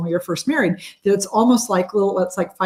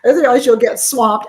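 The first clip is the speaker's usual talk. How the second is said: ordinary talk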